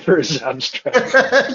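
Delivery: words squeezed out through a laugh. Real, not acted. Colleague joking he was replaced by AI, laughing. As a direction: laugh while finishing the joke. Joke about being replaced by AI.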